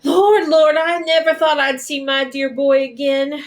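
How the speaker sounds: a mother crying out at her son's return; overjoyed cry. Acted. Mother reunited with her son.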